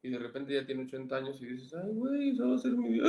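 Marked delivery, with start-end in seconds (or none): 1.7-3.1 high-pitched dismayed gasp of realization; sudden horror at seeing your own future; alarmed realization. Retold or imitated. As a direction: voice jumps up in alarm.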